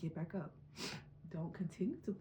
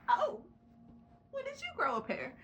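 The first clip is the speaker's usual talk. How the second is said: squeaky, much higher and louder teasing voice; mocking inner voice; 5 dB louder than usual. Vlogger voicing her surprised inner critic, squeaky and loud. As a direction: play the nagging voice in your head, shrill with surprise. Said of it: "the little voice in your head's gonna be like".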